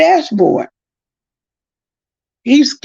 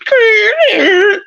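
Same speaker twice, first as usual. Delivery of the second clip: high drawn-out skidding noise; imitated car screech; 13 semitones higher, 3 dB louder than usual. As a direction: mimic the brakes squealing, high-pitched. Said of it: "then the car was just".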